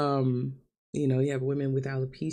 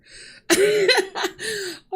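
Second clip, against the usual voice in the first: high, teary laugh; real nervous laugh through tears; 6 dB louder than usual. Real, not acted. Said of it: "insecure about my hair".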